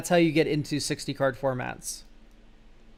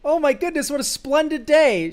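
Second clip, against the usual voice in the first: high, sing-song mock rapture; hammed-up joy; mock delight; 9 semitones higher, 3 dB louder than usual. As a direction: gush over the lucky draw. Delighted by a great draw.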